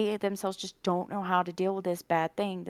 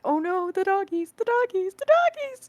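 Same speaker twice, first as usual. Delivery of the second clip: rising wail, much higher and louder each time; wailing over the doggies; 13 semitones higher, 6 dB louder than usual. Retold or imitated. Fan belting through the comic, wailing about the doggies. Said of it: "just like".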